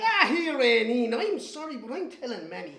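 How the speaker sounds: sorry, but not sorry at all; tattling little brother. Retold or imitated. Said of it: "and he'd say".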